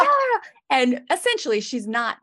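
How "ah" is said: very high, loud "ah" wail; imitated screaming kid; mock child scream. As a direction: let out a high kid's shriek. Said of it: "is just screaming".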